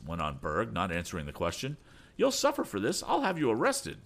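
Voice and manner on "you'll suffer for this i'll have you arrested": much higher furious threat; threatening. Acted. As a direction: Berg threatens them. Berg threatens Tom.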